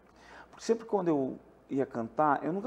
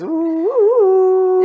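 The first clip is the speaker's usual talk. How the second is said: flute sound imitation; 12 dB louder, much slower than usual. Shown as a demonstration. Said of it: "Fazer o som com a flautinha".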